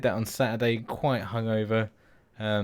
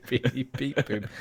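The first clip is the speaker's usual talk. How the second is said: laughing robot beeps; 4 semitones higher, slower than usual. Pretend. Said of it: "sound like a fucking robot".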